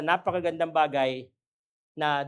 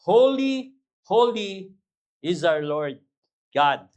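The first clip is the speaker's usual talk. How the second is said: proclaimed scripture; 6 dB louder, much slower than usual. Acted. Preacher proclaims scripture quote.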